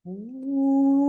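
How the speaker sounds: long, held howling wind sound; wind whoosh. Acted. Storyteller making the wind blow, long ooh. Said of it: "the wind would start to blow".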